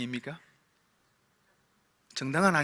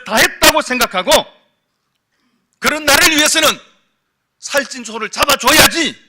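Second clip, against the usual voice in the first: louder, rising preacher's climax; preaching at full force; 5 semitones higher, 8 dB louder than usual. Acted. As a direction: drive the point home to the congregation. (힘차게 외치는 설교조)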